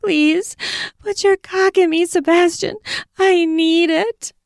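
high pleading whine; whiny pleading. Acted. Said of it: "sexy voice getting whiny".

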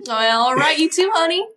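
sweet Southern honey; folksy Southern accent. Acted. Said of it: "Judy has this accent".

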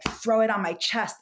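normal talking voice

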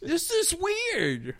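put-on high, slow dopey kid voice; goofy kid voice. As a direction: play it dumb and wide-eyed, slowly. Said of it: "and he's like".

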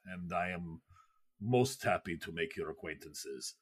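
put-on low, soft, slow Russian-accented official; a quiet courteous Soviet politician; Khrushchev voice. Khrushchev introducing himself to the ladies, low and soft. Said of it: "And he's like".

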